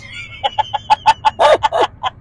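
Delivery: squealing laugh; high laugh. Real, not acted. Laughing at own line.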